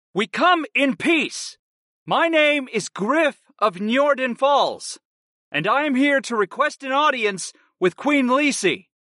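high, slow call to the guard. A narrator's reading. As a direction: call it out slowly through a gas mask. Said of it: "I repeated myself in a louder voice".

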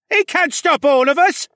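loud, high-pitched yell from the crowd. A narrator's reading. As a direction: shout it out as an angry mob member. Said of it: "a man in the crowd yelled".

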